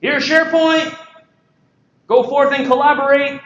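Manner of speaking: pompous rollout voice; grand IT announcement. Acted. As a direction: hand it over like a royal decree. Voicing IT handing over tool.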